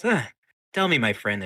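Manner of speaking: loud, high opening that drops; mock-friendly interrogator. Myrna walking up to the rat, loud and mock-friendly. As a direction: greet the captive with showy friendliness.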